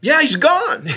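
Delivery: very high, exclaimed disbelief; incredulous confirmation. Retold or imitated. Astronaut answering stunned host, high incredulous exclamation. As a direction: pitch jumps up, still amazed. Answers host's "Wait, what?.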